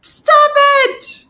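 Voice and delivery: high, full-voiced scream at the dog; loud, very high-pitched yelled command. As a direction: scream the commands at the top of your voice. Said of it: "I'm screaming".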